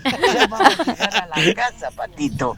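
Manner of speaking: patient soothing. Retold or imitated. Retelling a stranger calming his little boy, patient hushed soothing. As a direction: lull an upset child, trailing down softly. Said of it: "la paciencia, diciéndole".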